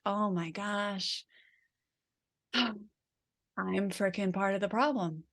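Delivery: slow, soft, deflated sigh; defeated self-talk. Retold or imitated. Parent blaming herself for the child's hitting, slow and deflated. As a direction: sag under the blame, slowly and quietly. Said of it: "talk to yourself differently when you have that tone than versus".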